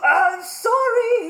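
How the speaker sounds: slow, high, loud apology; spluttering dragon. Acted. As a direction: sputter out a scared sorry. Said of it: "he spluttered".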